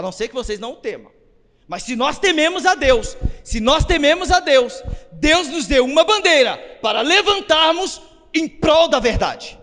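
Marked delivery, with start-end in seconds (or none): 1.7-9.6 raised, high-pitched sermon delivery; fervent preacher rallying the church; impassioned preaching. Real, not acted. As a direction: preach it with conviction, driving each phrase. Preaches with rising force.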